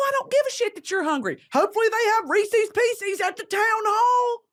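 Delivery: frazzled mother yelling about the Cane's line; exasperated parent yelling. Acted. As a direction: shout at the kids to hurry up. Scolding kids running late.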